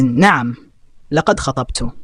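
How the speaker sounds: usual speaking voice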